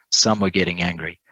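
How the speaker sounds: normal talking voice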